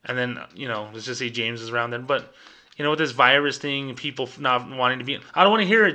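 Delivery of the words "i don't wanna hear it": a mock manager who won't take excuses; impatient boss. Manager cutting off banker James, loud, impatient scolding. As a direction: cut him off, sharp and loud, higher.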